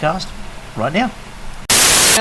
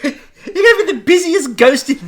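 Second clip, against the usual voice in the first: laughing; 7 semitones higher, 5 dB louder than usual. Real, not acted. Laughing at ghost joke.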